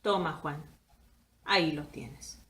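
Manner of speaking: soft, low, tender words to a dead brother; conmovido y cariñoso. A narrator's reading. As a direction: hand it over gently, deeply moved. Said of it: "diciendo conmovido y cariñoso". (en voz baja, conmovido y cariñoso)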